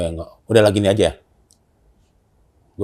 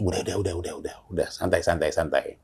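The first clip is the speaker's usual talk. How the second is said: softer, lower soothing voice; calming; 7 semitones lower, 8 dB quieter than usual. Retold or imitated. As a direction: settle her down gently. Calming panicked teammate as leader. (menenangkan dengan suara lembut)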